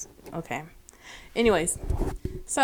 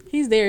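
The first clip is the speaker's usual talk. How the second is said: He's there said louder the second time; stressed repeat; 3 semitones higher, 8 dB louder than usual. Shown as a demonstration. Telling her friend he was home, He's there said louder.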